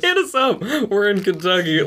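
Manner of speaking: high-pitched, laughing mimicry; mock the pitch while cracking up; laughing mockery. Laughingly mocks co-host's sponsor plea.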